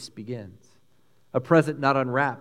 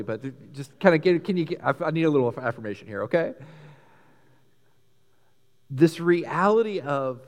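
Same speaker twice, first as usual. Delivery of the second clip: normal talking voice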